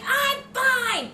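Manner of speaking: very high, loud, defiant mom; imitated mom. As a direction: insist shrilly like mom brushing off concern. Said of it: "She's like".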